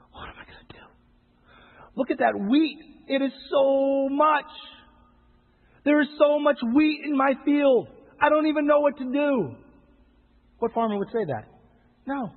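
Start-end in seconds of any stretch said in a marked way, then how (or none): 0.1-0.9 a farmer's frightened whisper; hushed, mock-fearful aside; mock panicked whisper. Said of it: "What farmer would look at his field right now and say". 1.9-9.5 high, slow, hammed-up whine; higher, louder mock despair, drawn out slowly; mock overwhelmed farmer. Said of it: "What farmer would look at his field right now and say".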